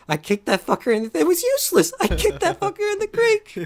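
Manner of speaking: high, loud, cackling confession; laughing mock confession. Mike confessing to the others, laughing wildly. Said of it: "He's like".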